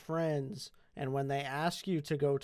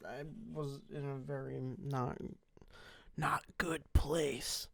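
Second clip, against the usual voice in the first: whispering; 6 dB quieter than usual. Real, not acted. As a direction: breathe it out softly. Whispered confession.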